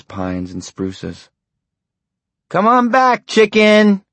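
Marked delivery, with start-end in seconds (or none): 2.5-4.0 much higher, louder taunting call. A narrator's reading. Boy calling after his friend to come back, taunting. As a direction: call him out across the woods. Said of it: "Norm called".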